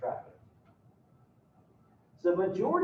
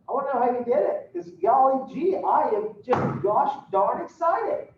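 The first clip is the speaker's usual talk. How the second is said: goody-goody dad. Retold or imitated. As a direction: play the golly-gee dad gushing over his kids. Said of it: "and Dad says".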